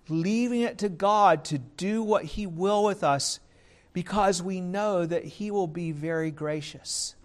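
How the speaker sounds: ordinary talk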